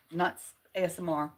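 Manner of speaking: ordinary talk, nothing marked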